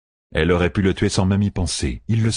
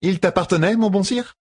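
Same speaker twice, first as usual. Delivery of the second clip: marchande aiguë et mielleuse; a sly merchant woman, pitched high; voix de marchande; 13 semitones higher than usual. Acted. La marchande parle à Rand. (voix aiguë de marchande rusée)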